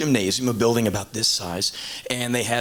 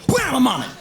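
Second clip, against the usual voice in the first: cocky catch; 11 semitones higher, 4 dB louder than usual. Retold or imitated. Ken's reflex catch voiced.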